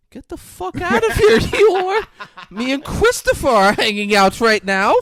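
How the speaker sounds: high, laughing shooing voice; angry Pooh. Retold or imitated. Pooh chasing Eeyore off, high laughing snap. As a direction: shoo him off in a high voice. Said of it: "And Pooh's like".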